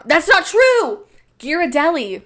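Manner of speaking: high, drawn-out mock outburst; exclaimed self-correction. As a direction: cry it out slowly, catching yourself. Suddenly corrects own claim.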